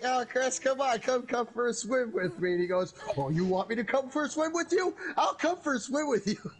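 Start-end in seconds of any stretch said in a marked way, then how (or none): 0.0-2.5 high, sing-song taunting invitation; teasing taunt. Retold or imitated. Taunting a friend to follow him into the water, high and teasing. 3.2-6.5 high, playful threat with a laugh; mock menacing reply. Friend answering the taunt with a high, laughing threat.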